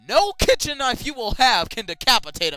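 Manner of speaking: loud, high exasperated lecture; fed-up loud explaining; exasperated correction. Real, not acted. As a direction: shout the correction. Correcting the story angrily.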